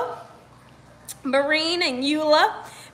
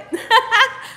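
high excited giggle; 8 semitones higher, 3 dB louder than usual. Real, not acted. Host alone on camera, giggling with excitement. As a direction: let a happy laugh burst out. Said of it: "I'm all excited".

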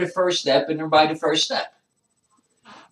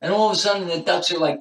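ordinary talk

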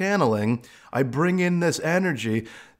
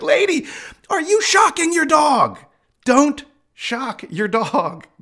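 high, louder exasperated yell; louder high protest; mock yell; 11 semitones higher, 4 dB louder than usual. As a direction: shout at the lady to stop. Yelling at owner shocking dog.

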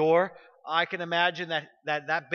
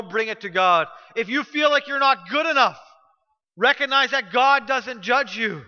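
louder, higher preaching push; passionate preaching; 3 semitones higher, 4 dB louder than usual. Acted. Pastor urging the church to bring their sins, fervent preaching. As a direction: drive the altar call home. Closing altar call.

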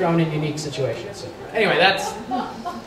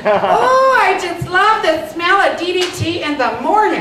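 put-on much higher, louder Minnesota accent, gushing and laughing; Trigger's Minnesota accent; 12 semitones higher, 5 dB louder, slower than usual. Actor showing fans his drag role, in high Minnesota accent. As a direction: gush in a high Minnesota accent like a boy-crazy twin sister. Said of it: "She's got that sort of Minnesota accent".

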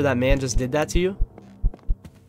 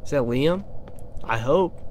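usual speaking voice

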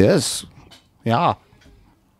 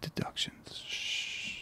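whispering; 22 dB quieter, much slower than usual. Real, not acted. Hushing teammates during a guess.

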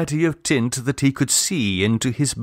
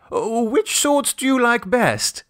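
much higher, eager father's question breaking through; betrayed eagerness; 11 semitones higher than usual. A narrator's reading. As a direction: let the eagerness slip out. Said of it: "a distinct betrayal of eagerness in his voice".

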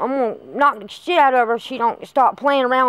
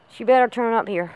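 ordinary talk, nothing marked

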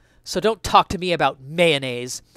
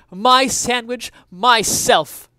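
higher, louder mock-heroic proclamation; defiant declaration; 7 semitones higher, 8 dB louder, much slower than usual. Essayist declares his sandwich identity to readers, defiant and loud. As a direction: proclaim it like a personal motto.

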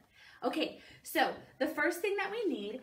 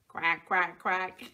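much lower quacking duck voice; 6 semitones lower, 3 dB louder, slower than usual. Acted. Asked what the duck says.

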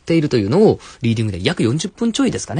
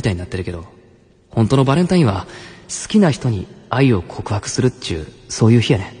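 acted Kansai-dialect suitor, pausing between phrases; Kansai dialect confession. Senpai confesses to Labumi before Valentine's, Kansai dialect. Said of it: "関西弁を使った告白のストーリー".

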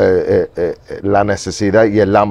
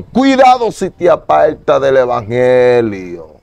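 slow, solemn warning from on high; solemn revelation voice; 3 semitones higher, 3 dB louder, slower than usual. Retold or imitated. Quoting the warning heard in the revelation, slow and solemn. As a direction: deliver it slowly like a divine warning. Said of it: "escuché en la revelación".

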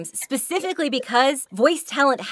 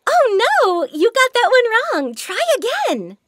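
put-on smiling kid-game voice; 11 semitones higher, slower than usual. Shown as a demonstration. Game voice cheering a child after a wrong answer, smiling high. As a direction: keep smiling while you tell them to try again. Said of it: "is to never stop smiling".

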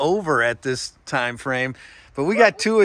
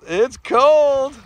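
excited; 7 semitones higher, 6 dB louder than usual. Real, not acted. Echoing her shock at the cold.

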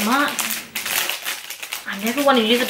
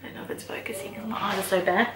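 breathy whisper to herself; whispered side comment; whispered aside; 3 semitones lower, 9 dB quieter, much faster than usual. Real, not acted. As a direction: whisper a quick worry. Muttering about camera focus.

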